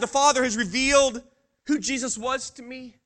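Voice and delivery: ordinary delivery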